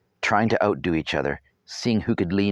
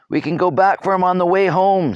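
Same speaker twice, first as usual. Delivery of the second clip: put-on high, loud boy's voice, matter-of-fact; stating the obvious; 12 semitones higher, 7 dB louder than usual. A narrator's reading. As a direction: point out the obvious loudly. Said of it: "stating the obvious".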